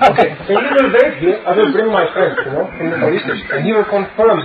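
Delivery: put-on light German accent, laughing a bit; a German policeman, half-laughing. Policeman quoted with German sounds.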